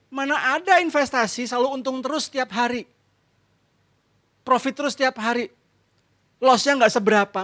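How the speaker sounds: high, scolding wife, quick at first; exasperated wife. Retold or imitated. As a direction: play the fed-up wife telling him off. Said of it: "Istrinya ngomong". (istri yang kesal, suara tinggi menegur)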